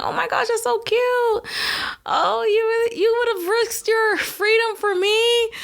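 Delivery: high, gushing mock-flattered voice; mock swooning girl. Pretending to be flattered by a thief's offer, gushing. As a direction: gush like a smitten girlfriend. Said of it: "I probably would've been like".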